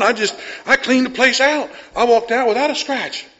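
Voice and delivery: breathless hyped-up son, with a laugh; all excited. Retold or imitated. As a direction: talk like a kid who can't wait to tell Dad. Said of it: "he's like all excited".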